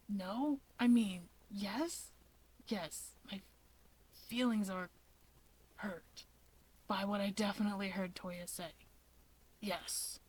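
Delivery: soft, halting, half-asleep mumble; dazed mumble. Acted. Shigaraki mumbling agreement to his friends, dazed and soft. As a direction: trail off as if barely awake.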